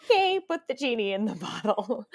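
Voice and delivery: laughing rein-in. Retold or imitated. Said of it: "Michelle would be like".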